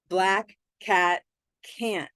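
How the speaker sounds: slow, careful pronunciation drill; slow demo. Shown as a demonstration. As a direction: say it slowly and deliberately. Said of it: "that was a bit tricky".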